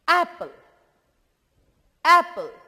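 एप्पल with a clear open a sound; sound out apple. Shown as a demonstration. Teacher models एप्पल for the class. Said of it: "ध्वनि को पकड़िएगा".

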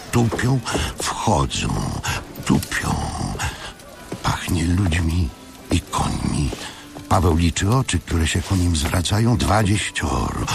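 ordinary delivery

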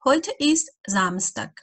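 each word laid out slowly for learners; slow dictation German. Acted. Teacher reading an example sentence to beginners, slowly. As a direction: read it out slowly and clearly for beginners.

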